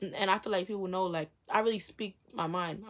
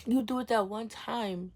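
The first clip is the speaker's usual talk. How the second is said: ordinary delivery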